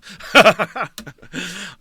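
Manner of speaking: loud, high burst of laughter. Real, not acted. After telling themselves not to ditch the story, loud laugh. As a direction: crack up loudly at yourself.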